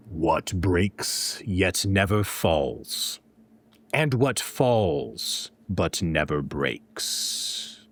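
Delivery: low, very slow incantation; slow eerie riddle voice. Acted. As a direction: intone the riddle slowly, trance-like.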